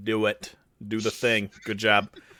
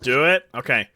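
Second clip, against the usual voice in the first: usual speaking voice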